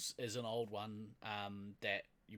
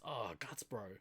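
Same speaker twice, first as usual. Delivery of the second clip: disappointed mate; 4 semitones lower, 7 dB quieter, slower than usual. Shown as a demonstration. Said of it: "somebody is disappointed".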